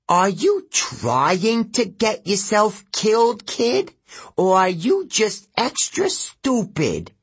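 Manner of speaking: high, slow, sneering creature voice; mock the kid like a seal god; sneering river god. East River god speaks.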